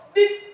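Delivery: high, loud honk imitation; car horn beep. Servant pretends to be a car and honks, high and loud. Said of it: "Η κόρνα".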